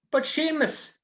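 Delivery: high, startled priest voice; shocked priest. Acted. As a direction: cry out his name in disbelief. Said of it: "says the priest".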